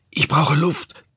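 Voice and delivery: choking gasp, the cry breaking high; death rattle. A narrator's reading. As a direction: rasp it out with no air left. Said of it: "Meine Worte sind kaum mehr als ein Röcheln".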